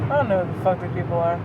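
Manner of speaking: ordinary delivery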